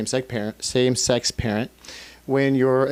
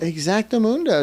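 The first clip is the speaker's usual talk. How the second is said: playful exactamundo; 8 semitones higher than usual. Real, not acted. Playful agreement.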